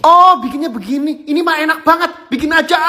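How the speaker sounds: high, bright eager voice; excited squeal. Real, not acted. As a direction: gush excitedly about the food. Excited reaction to a recipe clip.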